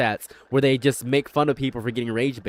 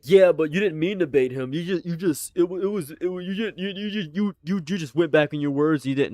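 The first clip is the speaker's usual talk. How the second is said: higher, stammering protest; imitated objectors; 4 semitones higher than usual. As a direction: mimic a flustered objector tripping over words. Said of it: "People were like".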